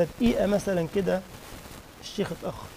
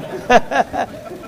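breathy laugh; 4 semitones higher, 4 dB louder than usual. Real, not acted. Laughing at audience's word for fan.

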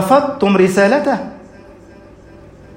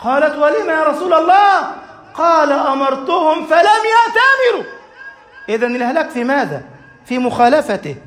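an impassioned sermon climax; fiery preaching; 6 semitones higher than usual. Acted. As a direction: drive the lesson home at full force. Preaching at full force.

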